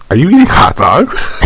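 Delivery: a coworker grossed out by lunch, asking with disgust. Retold or imitated. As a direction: sound grossed out by the food. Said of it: "a little disgusted by it".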